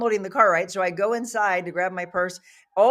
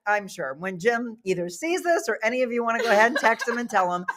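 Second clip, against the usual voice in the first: usual speaking voice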